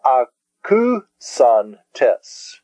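slow, separated a-ku-san-tes; syllable by syllable. Shown as a demonstration. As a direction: sound out akusan tes one syllable at a time. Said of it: "In the word".

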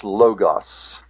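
LOGOS said LOW-goss, long first o; common mispronunciation. Shown as a demonstration. Teacher quotes how people wrongly say it, LOGOS as LOW-goss. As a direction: say LOGOS with "oh" first, short ending. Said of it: "Now I hear people call this".

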